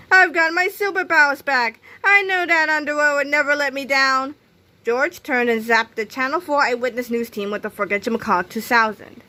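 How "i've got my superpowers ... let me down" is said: high, loud heroic exclamation; crying out. A narrator's reading. Captain Underpants rejoicing over returned superpowers, crying out. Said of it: "cried the good captain".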